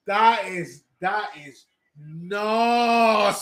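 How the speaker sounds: drawn-out, loud "nasty"; hyped up. Real, not acted. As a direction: stretch it out in pure awe, slowly. Hyped reaction to a big dunk.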